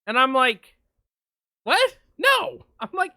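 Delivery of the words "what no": high, loud what and no; incredulous retold outburst. Driver reacting as the bunny grabs the pump, high incredulous outburst. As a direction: snap back in disbelief.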